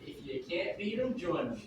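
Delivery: quiet. Real, not acted. Volunteer quietly fills blank.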